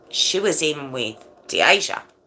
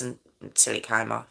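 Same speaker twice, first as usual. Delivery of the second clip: softer, lower, matter-of-fact quote; deadpan retelling; 4 semitones lower, 9 dB quieter, faster than usual. Retold or imitated. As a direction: imitate a detached confession, quietly and without drama. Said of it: "Exactly how I'm telling you".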